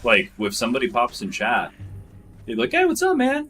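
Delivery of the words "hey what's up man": much higher, chirpy streamer hello; YouTuber greeting. Retold or imitated. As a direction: greet chat like a fake-cheery host. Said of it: "it'd be really weird".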